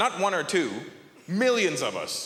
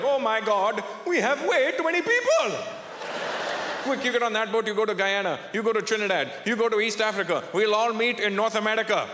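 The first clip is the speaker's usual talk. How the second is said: higher-pitched, alarmed Indian organizer; high, urgent comic Indian voice; Indian inventory taker; 4 semitones higher than usual. Acted. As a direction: hand out destinations in a mock panic. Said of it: "like we were doing inventory".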